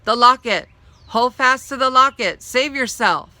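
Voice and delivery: loud, high urgent yell; yelled warning. A narrator's reading. Dog yelling after fleeing Molly, loud and urgent. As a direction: shout the warning across the fire. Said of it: "the dog yelled".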